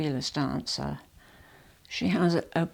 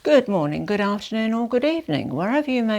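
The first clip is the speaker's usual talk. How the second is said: big bright welcome, loud and rising; loud cheery greeting; 5 semitones higher, 7 dB louder, faster than usual. Real, not acted. Vlogger greeting her viewers loudly and brightly. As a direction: call out the greeting to the whole world. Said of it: "bright greeting".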